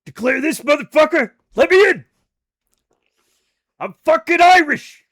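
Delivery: loud, high mock yell at customs; hammed-up shouted comeback. Imagined reply to the customs officer, loud mock shout. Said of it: "And I'm like".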